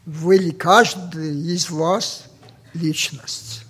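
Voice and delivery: normal talking voice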